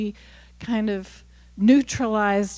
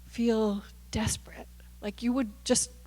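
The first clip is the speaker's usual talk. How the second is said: breathy whisper dropping to near silence; softly whispered confession; real whisper; 9 dB quieter than usual. Real, not acted. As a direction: let it fall to a hushed whisper. Intimate point about desperation.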